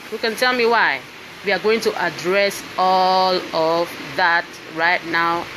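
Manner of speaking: ordinary delivery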